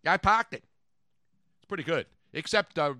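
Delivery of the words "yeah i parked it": riffing on the Boston commercial; mock Boston accent. Host riffs on the car ad for cohosts, mock Boston accent.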